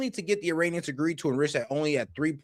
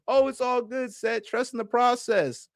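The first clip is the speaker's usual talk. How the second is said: mocking; 7 semitones higher than usual. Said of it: "mocking Seth's reassurance".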